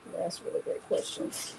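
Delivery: whispered praise. Real, not acted. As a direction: murmur it under your breath. Aside to Dorothy.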